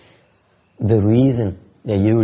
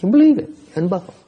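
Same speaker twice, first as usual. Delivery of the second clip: mock triumph; 9 semitones higher, 4 dB louder, faster than usual. Mocking the ghost's victory.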